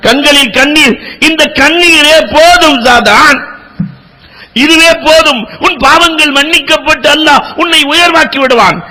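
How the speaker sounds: high, lifted preacher's voice; impassioned preaching. Acted. Preacher tells the congregation of the singer's tears, impassioned. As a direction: preach it with rising fervour.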